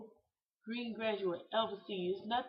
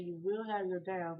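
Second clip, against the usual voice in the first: plain reading voice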